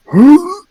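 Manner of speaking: loud hammed-up retch; fake retching gasp. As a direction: gag loudly like you're sick. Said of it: "I make the most awful retching sounds".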